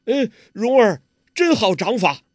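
a loud, high admiring cry; eager praise. Acted. Guo Jing praising Huang Rong's palm technique, loud eager admiration. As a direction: gush with honest admiration.

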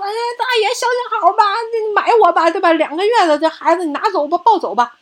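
put-on pleading child voice. 孩子向买主求买自己.

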